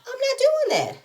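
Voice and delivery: very high-pitched mock protest; hammed-up high-voiced objection; acted refusal. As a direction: squeal the refusal, imitating an upset friend. Acted refusal in retold quarrel.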